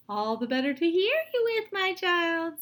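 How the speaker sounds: high, loud wolf in disguise. Acted. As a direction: answer slyly as the wolf. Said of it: "said the wolf".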